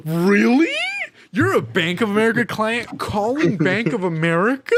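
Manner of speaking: hammed-up amazed reply; mock astonishment. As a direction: act stunned at the obvious. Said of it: "and I'm like".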